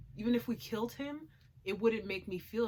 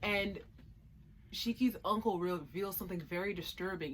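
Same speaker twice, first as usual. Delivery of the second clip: normal talking voice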